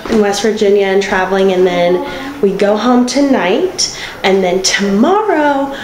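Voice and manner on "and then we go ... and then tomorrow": ordinary talk, nothing marked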